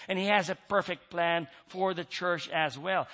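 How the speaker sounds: ordinary delivery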